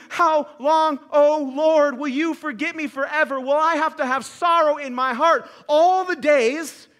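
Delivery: crying out. Acted. David crying out to the Lord in Psalm thirteen, loud lament. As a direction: cry it out to God in anguish. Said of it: "like David crying out".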